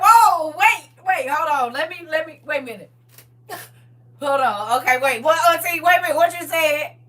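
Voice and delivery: a hammed-up stop-the-car panic; flustered aunt. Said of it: "She's like".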